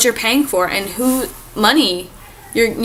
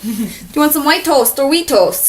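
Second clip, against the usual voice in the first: mock Minnesota accent; 5 semitones higher, 3 dB louder than usual. Mock local host, after accent joke.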